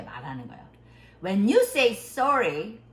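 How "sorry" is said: "sorry" said firmly, without a smile; sincere firm "sorry". Shown as a demonstration. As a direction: give "sorry" slow, serious weight. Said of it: "don't smile". ("sorry"를 웃음기 없이 또박또박)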